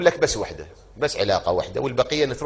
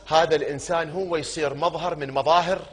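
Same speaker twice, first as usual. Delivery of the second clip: ordinary talk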